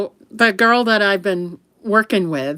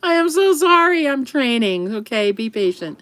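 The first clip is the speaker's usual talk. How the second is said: flustered apology; 6 semitones higher than usual. Retold or imitated. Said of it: "I'm like".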